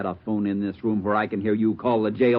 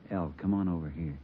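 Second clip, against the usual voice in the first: much softer, low; gentle; 4 semitones lower, 9 dB quieter than usual. Acted. Gunman softly calls his brother over, gentle. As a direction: coax him close.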